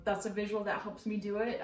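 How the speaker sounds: ordinary talk, nothing marked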